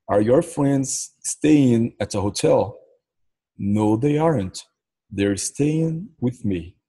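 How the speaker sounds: each word slow and clear for learners; slow model question with a rise at the end; slow teaching read. Shown as a demonstration. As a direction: sound it out slowly, like a teacher. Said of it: "Numa conversação em inglês".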